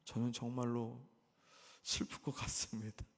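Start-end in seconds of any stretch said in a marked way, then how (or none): none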